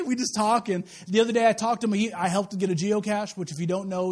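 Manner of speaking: normal talking voice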